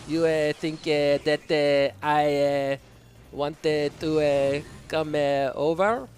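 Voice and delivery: slightly subdued mock Israeli accent, halting. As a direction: fake an Israeli accent with "eh" after each word. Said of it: "Israeli, uh, accent".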